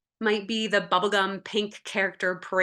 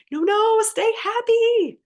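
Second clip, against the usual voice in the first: much higher, louder, slow pleading; high panicked plea to stay happy; fearful; 14 semitones higher, 6 dB louder than usual. Acted. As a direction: squeal in panic. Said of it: "fear fearfully says".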